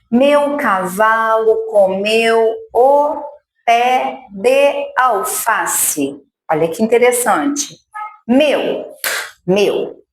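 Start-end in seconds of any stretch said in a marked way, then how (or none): 0.1-6.2 sentence dictated very slowly, word by word; slow dictation. Shown as a demonstration. Teacher dictating the sentence slowly for a child. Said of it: "vamos fazer isso aqui numa frase".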